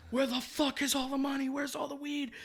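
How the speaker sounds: high, loud frantic cop shouting; mock cop yelling. Comic retelling cops tearing house apart, mock yelling. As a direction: bark it like a raiding cop.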